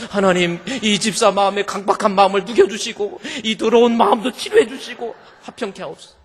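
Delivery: loud, high pleading prayer; hammed-up fiery intercession; fervent loud prayer. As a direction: pray over them at full force.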